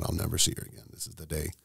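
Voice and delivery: hushed, almost breathless thought; half-whispered fear of never seeing her again; hushed despairing inner voice; quiet inner thought. Retold or imitated. Thought at fearing death.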